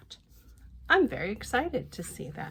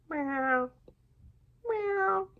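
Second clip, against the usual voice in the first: meow; 10 semitones higher than usual. Shown as a demonstration. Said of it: "Like that, I bet".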